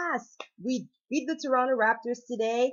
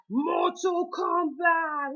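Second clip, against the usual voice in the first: slow, high, drawn-out announcer shout; announcer call; 9 semitones higher, much slower than usual. Pretend. Opening a movie review with the announcer's call.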